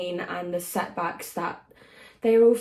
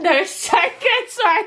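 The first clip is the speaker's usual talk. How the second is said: high, loud gushing; excited squeal; 11 semitones higher, 9 dB louder than usual. Real, not acted. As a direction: gush with delight. Gushing agreement.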